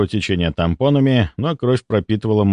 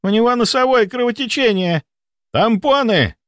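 high, urgent yell; alarmed yell; 12 semitones higher than usual. A narrator's reading. Volkonsky calls for tampons during a nosebleed, alarmed yell. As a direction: yell orders in alarm, pitch way up. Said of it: "заорал Волконский".

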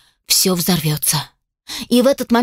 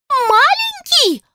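much higher, slow, outraged Маленький; shrill squeal; much slower than usual. Acted. Elf shrieks at the inventor calling it small, squealing. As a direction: squeal it in disbelief. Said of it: "взвизгнула она".

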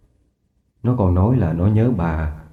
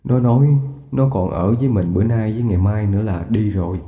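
1 grieving father; 5 semitones lower, 4 dB quieter than usual. Acted. Grieving father tells wife of dream.

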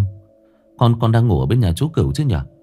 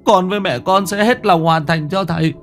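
high, wailing crying plea; weeping; 9 semitones higher than usual. A narrator's reading. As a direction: sob out a plea to the dead. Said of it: "ôm mặt khóc".

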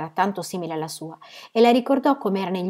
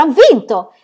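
louder, higher victory cry; triumphant shout; 7 semitones higher, 12 dB louder, slower than usual. Acted. Aunt shouting her win again on the ground. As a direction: throw the win out even harder. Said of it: "gridò allora".

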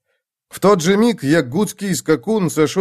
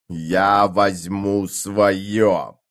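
very slow, lower, hollow metallic voice; booming metallic voice; 5 semitones lower, much slower than usual. Acted. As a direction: intone it like an undead armored knight. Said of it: "произнёс гулкий металлический голос".

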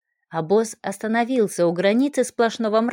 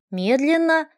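ordinary delivery, nothing marked

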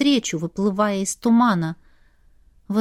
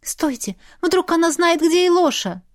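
urgent cry; 8 semitones higher than usual. A narrator's reading. Heroine cries out to stop.

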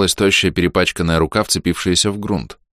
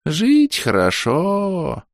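блаженно; 11 semitones higher, much slower than usual. A narrator's reading. Дед снова блаженствует.

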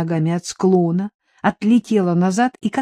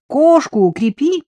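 high, loud urgent warning; raised, anxious-to-be-heard call; hurried shout; 8 semitones higher, 5 dB louder than usual. A narrator's reading. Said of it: "торопливо закричал Стёпка".

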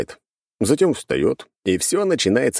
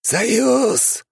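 eldritch horror. A narrator's reading. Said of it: "только и сказал гость".

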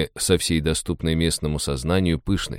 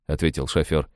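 ordinary delivery, nothing marked

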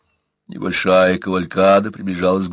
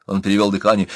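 ordinary delivery

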